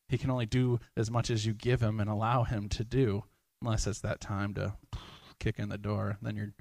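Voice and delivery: ordinary talk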